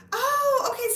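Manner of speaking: loud high laugh on oh. Real, not acted. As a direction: burst out laughing in surprise. Laughing at a funny find.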